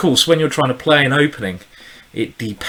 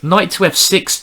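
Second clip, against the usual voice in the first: ordinary talk, nothing marked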